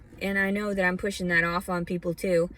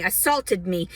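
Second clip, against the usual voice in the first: ordinary talk, nothing marked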